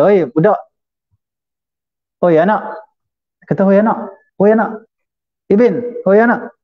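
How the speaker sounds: high-pitched hail, retold; imitating a brusque summons; calling out. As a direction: call out "oi" like summoning a kid. Acting out man hailing the Prophet.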